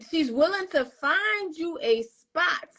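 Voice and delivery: ordinary talk, nothing marked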